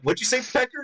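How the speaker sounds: high, mock teasing jab. Mocking Josh with his new nickname, teasingly. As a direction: needle him with the nickname. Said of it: "I'm like".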